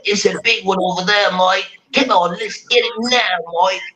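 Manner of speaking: high-pitched put-on Australian accent; Irwin. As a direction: play an Australian crocodile hunter spotting a beast. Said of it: "the name Irwin or whatever".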